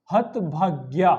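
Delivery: हतभाग्या slowly, in separated syllables; correct form. Shown as a demonstration. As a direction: stretch हतभाग्या out, long final आ. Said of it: "ये शुद्ध शब्द है". (हतभाग्या का धीमा, शुद्ध उच्चारण)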